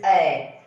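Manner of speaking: "A" said as eh, the sound in red; short E sound. Shown as a demonstration. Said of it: "E says A".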